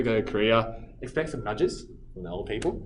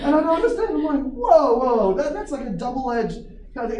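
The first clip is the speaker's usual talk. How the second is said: high, loud disbelief; exasperated; 10 semitones higher, 8 dB louder than usual. Real, not acted. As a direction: throw up your hands in loud disbelief. Exasperated retelling.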